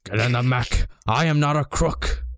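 mild Nixon growl on the crook line; Nixon impression. Gendo as Nixon insisting, lightly voiced Nixon. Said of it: "Gendo as Richard Nixon".

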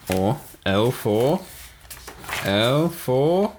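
dragging out L for, pitch rising; stalling for time, stretching each word; slow drawn-out searching. Real, not acted. Hunting for a word starting with L, drawn out and stalling.

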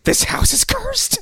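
high, slow, loud eerie voice; hammed-up ghostly screech; mock horror prophecy. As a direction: pronounce a curse like a possessed woman. Possessed horror line, acted.

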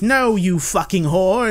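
mock angry retort. Beaten man snaps at the girl, mock anger. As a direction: spit it out in mock outrage. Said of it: "And he's like".

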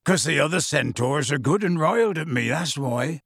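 usual speaking voice

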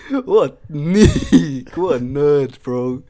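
loud, high, drawn-out jeer; high mocking taunt. Real, not acted. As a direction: draw the insult out in mockery. Mocking friend's insult with laughter.

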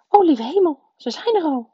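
high, loud flustered cry; agitated. A narrator's reading. Aunt Petunia hears the guests at the door, agitated. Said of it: "riep tante Petunia geagiteerd".

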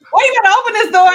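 high, loud indignant demand; yelling. Retold or imitated. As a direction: holler it at the neighbor through the door. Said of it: "they were yelling at my neighbor".

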